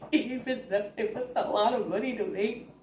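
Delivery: laughing through the words, real giggles; can't stop laughing while saying it. Real, not acted. Telling viewers about Avatar's budget, laughing hard. Laughing at box office numbers.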